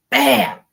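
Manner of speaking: high, loud sharp bam; sudden loud bam of a stick hitting; imitated bang. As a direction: punch out the noise of the stick.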